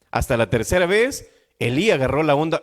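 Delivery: ordinary delivery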